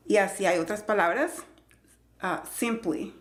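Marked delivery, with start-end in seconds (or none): none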